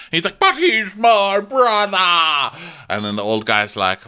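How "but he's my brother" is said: high, loud, drawn-out mock wail; outraged protest. King defends his brother to his adviser, outraged. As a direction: protest it loudly, stretching every word.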